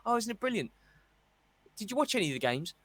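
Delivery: mocking fan who didn't watch the games; gushing fan mimic. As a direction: lampoon a bandwagon fan. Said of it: "people who've been going".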